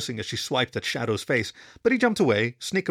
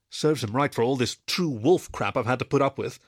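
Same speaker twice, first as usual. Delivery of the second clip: usual speaking voice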